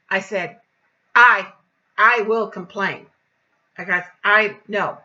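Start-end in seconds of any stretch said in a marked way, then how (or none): 1.1-3.1 very loud, emphatic warning; indignant raised voice; forceful threat. Retold or imitated. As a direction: lay down the threat loudly. Retold threat in quarrel.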